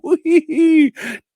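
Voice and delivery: very high, loud ghoulish cackle; evil cackle. Retold or imitated. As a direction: cackle like a ghoul closing the show. Crypt Keeper sign-off.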